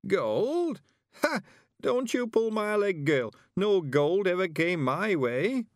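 put-on high, loud incredulous old voice; scoffing old man. A narrator's reading. Mister Weevil scoffing at Tiffany's claim of gold. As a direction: laugh it off in disbelief, slowly.

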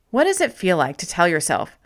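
normal talking voice

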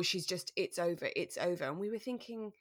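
ordinary talk

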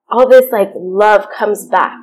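usual speaking voice

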